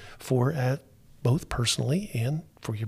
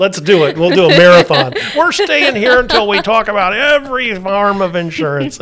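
excited laughing; 5 semitones higher, 11 dB louder than usual. Real, not acted. Laughing excited reply to guest.

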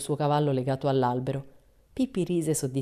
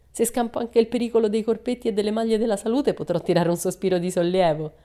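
Pippi voice; 6 semitones higher, 5 dB louder than usual. A narrator's reading. Pippi's bright child voice.

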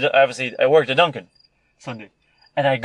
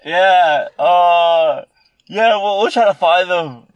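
lazy, higher-pitched cop brushing it off; mock cop; 3 semitones higher, 5 dB louder, much slower than usual. Teen mocks the cops who shrugged off his complaint, lazy cop voice. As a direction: play the indifferent officer promising nothing. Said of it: "the cops are just like".

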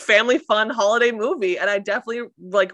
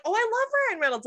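much higher, fast gushing voice; mock the boss's quick high gush; imitating the boss; 13 semitones higher, 4 dB quieter, faster than usual. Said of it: "And my boss is like".

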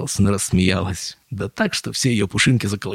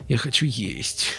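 slow, soft whine; small plaintive complaint; whimpering; 4 semitones higher, 3 dB quieter, slower than usual. A narrator's reading. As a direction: whine it hungrily, a little higher. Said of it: "захныкал он".

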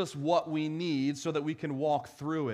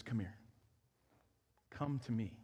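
softer, hushed call to come; a hushed voicing of Jesus inviting the weary; tender invitation; 5 semitones lower, 8 dB quieter than usual. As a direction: speak it like a gentle, quiet welcome.

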